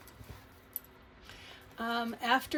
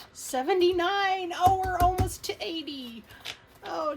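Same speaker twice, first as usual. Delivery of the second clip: higher and louder, thrilled by the total; excited; 8 semitones higher, 7 dB louder than usual. Real, not acted. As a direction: squeal with delight at the count. Counting savings, nearly reaching eighty.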